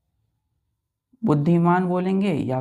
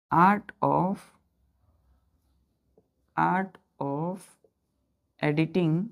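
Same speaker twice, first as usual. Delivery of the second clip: words dropped one at a time with long pauses; slow dictation; much slower than usual. Shown as a demonstration. Teacher dictating a meaning to children, slow with long pauses. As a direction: give each word slowly so children can write it down. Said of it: "teacher dictating meaning with pauses".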